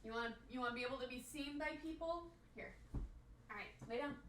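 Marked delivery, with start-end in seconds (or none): 2.5-4.1 breathy whisper to the dog; whispered command. Real, not acted. Owner whispering the dog into bed. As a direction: hush him down to rest.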